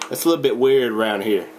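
ordinary talk

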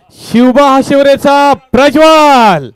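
high, drawn-out announcer call; winner reveal. Acted. Host revealing the best bowler to the crowd, ringing drawn-out announcement. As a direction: stretch the winner's name out grandly.

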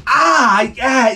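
sudden cry of pain; pained yelp. Acted. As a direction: yelp as you get stung. Stung by mosquito.

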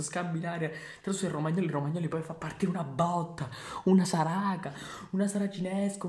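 high, loud live goal commentary; excited play-by-play. Acted. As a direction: call the goal like a frantic commentator.

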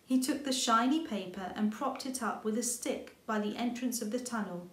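ordinary delivery

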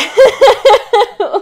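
loud burst of laughter; laughing. Real, not acted. Laughing at the topic.

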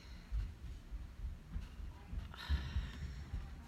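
long, quiet acted sigh; soft, weary retold sigh; exasperated sigh. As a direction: let out a drawn-out sigh of mock dismay. Her retold reaction to eager boy.

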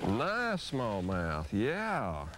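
normal talking voice

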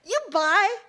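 high, loud disbelief; incredulous dog. Acted. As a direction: scoff at the man's claim.

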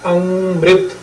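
ਅੰਮ੍ਰਿਤ with a held nasal first syllable; pronunciation demo. Shown as a demonstration. Teacher showing reciters how the word is read, careful demo of ਅੰਮ੍ਰਿਤ. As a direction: say ਅੰਮ੍ਰਿਤ drawn out on the opening nasal. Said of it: "ਟਿੱਪੀ ਤੋਂ ਬਿਨਾਂ".

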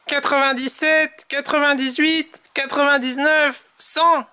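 high-pitched character voice counting off; counting Tom. A narrator's reading. Tom counts the endless rabbits, high character voice. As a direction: count each one high and insistent.

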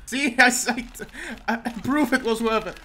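ordinary delivery

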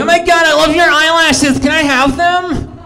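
mock valley girl gushing over eyelashes. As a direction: squeal over it like an excited fan.

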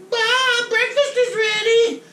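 high, sing-song mom calling out. Retold or imitated. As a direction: call him to breakfast like his mother. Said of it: "Am I your mom?".

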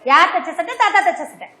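quick-fire demonstration for the class; fast tongue twister. Shown as a demonstration. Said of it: "வேகமா சொல்ல போறேன்".

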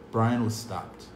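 usual speaking voice

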